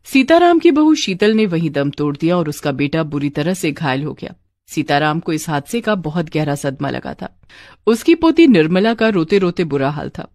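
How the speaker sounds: plain reading voice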